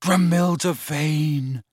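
slow, dreamy naming; soft, adoring. A narrator's reading. Lovesick lad naming his beloved, softly. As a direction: linger softly over the name. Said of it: "said Ron softly".

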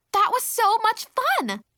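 high, slow, delighted squeal; thrilled. Acted. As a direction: gush with childlike glee. Excited child after running.

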